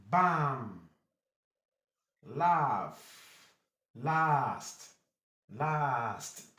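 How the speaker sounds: "Laugh", "Last" with a held "ah"; long A. Shown as a demonstration. Teacher drills long A words, drawn-out "Last". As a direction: stretch the open vowel in "Bam", "Laugh", "Last". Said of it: "gives you long ha".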